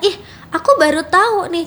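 mildly surprised. Pretend. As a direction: pretend you've only just found out. Host acts out a surprised discovery.

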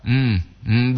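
normal talking voice

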